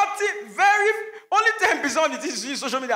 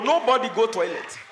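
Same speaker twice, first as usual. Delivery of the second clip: ordinary talk, nothing marked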